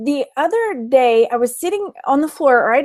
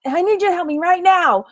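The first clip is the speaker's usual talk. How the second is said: mock all-caps demand; 5 semitones higher than usual. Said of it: "yell at me".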